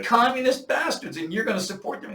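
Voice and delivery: retold angry rant. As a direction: rant at him like an outraged patriot.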